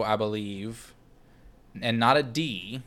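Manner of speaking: usual speaking voice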